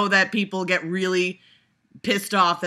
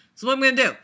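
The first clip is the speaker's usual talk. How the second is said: louder, higher insistent repeat; emphatic repeat; 6 semitones higher, 5 dB louder, much faster than usual. Real, not acted. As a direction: say it again like a firm decision.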